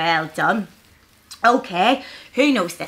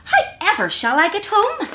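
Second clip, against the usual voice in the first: high, quick acted worry; little creature's high-pitched plea; gasping hedgehog; 10 semitones higher, 5 dB louder than usual. A narrator's reading. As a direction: fret it quickly as if struggling along. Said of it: "he gasped".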